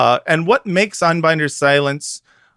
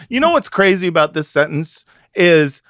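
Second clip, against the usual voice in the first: ordinary talk, nothing marked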